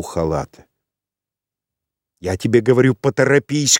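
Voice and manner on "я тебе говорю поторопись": higher, insistent call to hurry up; крикнула. A narrator's reading. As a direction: raise the voice, pressing him to hurry. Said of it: "крикнула она". (повышенный голос, настойчивый окрик)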